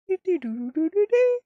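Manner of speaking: a high, squeaky little laughing trill; giggly hum. Real, not acted. Streamer giggling to himself on spotting a familiar spot, high squeaky trill. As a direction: hum a happy giggle up high, half singing.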